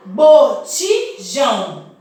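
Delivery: Botijão split slowly into bo-ti-jão; syllable by syllable. Shown as a demonstration. Teacher spelling out syllables for her class, slow and split. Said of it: "separar conforme vocês falam".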